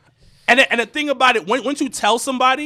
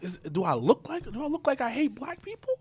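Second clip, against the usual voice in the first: very soft, wounded questioning; dejected; 13 dB quieter than usual. Retold or imitated. Insulted man brooding at the bar, dejected. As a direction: brood aloud, hushed, retelling the hurt.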